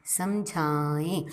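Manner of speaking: "समझाएं" stretched out very slowly while writing it; slow dictation. Shown as a demonstration. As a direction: draw out "समझाएं" letter by letter for the kids to copy. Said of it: "आगे ma'am आपको लिखना सिखाएंगी". ("समझाएं" को बहुत धीरे खींचकर लिखवाते हुए बोलना)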